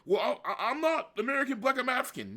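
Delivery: high, laughing mock protest; mock objector. Mocking an imagined objector.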